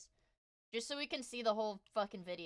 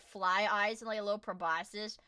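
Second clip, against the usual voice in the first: ordinary talk, nothing marked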